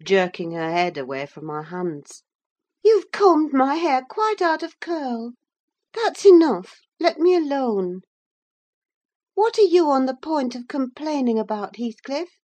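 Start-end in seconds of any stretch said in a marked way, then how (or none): 2.8-8.0 high, loud, petulant scolding; peevish girl's complaint. A narrator's reading. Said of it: "she added petulantly". 9.3-12.4 put-on high-pitched, insistent girl; young Catherine. A narrator's reading. Catherine demands an answer.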